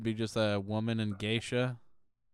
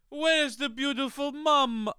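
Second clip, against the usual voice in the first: put-on very high, slow Italian accent; mock Italian; 11 semitones higher, slower than usual. Continuing the Italian bit in a very high, slow Italian accent. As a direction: ham up an Italian romantic. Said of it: "the Italian just starts".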